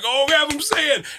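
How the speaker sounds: high-pitched mock pleading crowd; put-on excited fan plea; fans begging. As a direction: imitate eager fans in a high voice. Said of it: "they're like".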